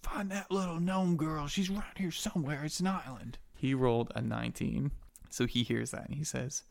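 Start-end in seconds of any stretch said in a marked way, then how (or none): 0.0-3.4 high-pitched, held-back mock yell; distant sailors yelling. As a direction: imitate a search party calling from afar. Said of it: "you hear sailors yelling".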